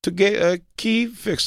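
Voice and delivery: slow, high, put-on voice of the woman; mimicking her. Jay imitating the woman's answer, high and slow. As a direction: play her slow and blank.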